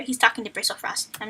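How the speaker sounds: usual speaking voice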